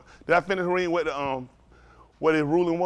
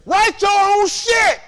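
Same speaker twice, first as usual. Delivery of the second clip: slow, louder, higher declaration; forceful; 12 semitones higher, 7 dB louder, much slower than usual. Real, not acted. Lecturing students on the Constitution, loud and slow. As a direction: hammer the point home. Preaching to students, rising heat.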